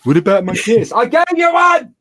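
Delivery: high playful voice. Real, not acted. Talks to a child offscreen.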